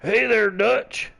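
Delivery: slow, high, put-on cowboy drawl; Arthur Morgan voice. Shown as a demonstration. Friends practicing Arthur Morgan impression, hammed-up drawl. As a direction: try a rough Texan outlaw greeting. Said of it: "let's hear yours".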